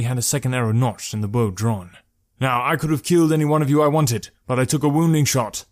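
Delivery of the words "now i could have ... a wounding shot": mildly raised, higher warning call. A narrator's reading. Archer to highwaymen after his warning shot, calling out. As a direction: call a firm warning across to them. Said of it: "Malik called to them".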